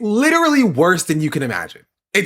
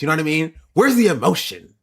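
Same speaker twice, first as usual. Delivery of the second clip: ordinary talk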